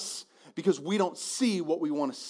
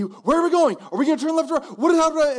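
put-on high, loud, rushed panicky child; a boy losing it because he doesn't know the route; freaking-out kid; 10 semitones higher, 7 dB louder, faster than usual. Son in the back seat freaks out at his parents, high and loud. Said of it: "he would freak out".

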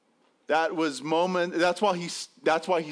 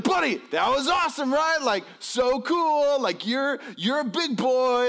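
oversell it to win him round; hammed-up upbeat dad; forced enthusiasm; 8 semitones higher, 3 dB louder than usual. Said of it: "trying everything I can do to, like, rescue this moment".

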